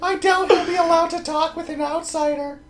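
put-on sing-song little girl diary voice. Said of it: "Read it in a little girl voice".

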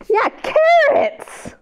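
very high, slow cheer; excited reveal. Acted. Reader cheering the carrot answer for children, excited.

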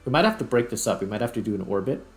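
usual speaking voice

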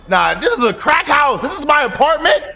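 high, loud disbelief; appalled outburst. Pretend. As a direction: protest loudly, rising into a question. Appalled by his apartment.

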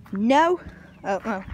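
ordinary delivery

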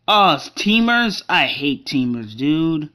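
loud, annoyed groan about the game; real gamer annoyance; exasperated outburst. Real, not acted. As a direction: burst out loudly in frustration, then drop in pitch. Rages at teamers in game.

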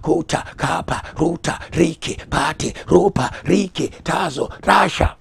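loud, high, punched syllables building up; building tongues. Real, not acted. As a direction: drive the tongues upward in force.

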